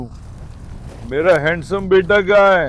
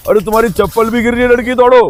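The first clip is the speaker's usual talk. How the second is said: higher-pitched urgent call; 5 semitones higher, 4 dB louder than usual. Real, not acted. Owner urging his dog to run, urgent calling out. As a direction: call out to hurry her along.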